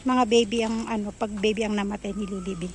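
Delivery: ordinary talk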